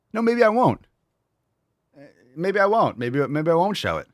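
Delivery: high, loud playful defiance; petulant mock refusal. Petulant refusal to show the merch.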